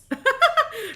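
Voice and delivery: loud, very high burst of laughter. Real, not acted. Player delighted by the mirror floor, high laugh.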